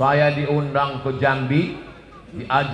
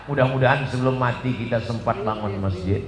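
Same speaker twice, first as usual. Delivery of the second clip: ordinary talk, nothing marked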